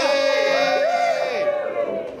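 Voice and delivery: rally the crowd for the picture; cheering shout. Real, not acted. Cheering for group photo.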